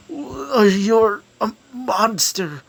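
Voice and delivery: pained accusation. Acted. Prisoner in agony accusing the Insect King, pained cry. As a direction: gasp it out slowly through the pain. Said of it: "starts screaming in pain".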